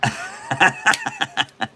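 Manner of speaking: a sudden high-pitched burst of laughter. Real, not acted. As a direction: cackle up high at the joke. Reacts to the puppet master joke.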